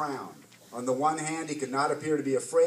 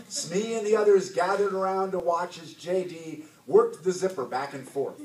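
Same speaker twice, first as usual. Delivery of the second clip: usual speaking voice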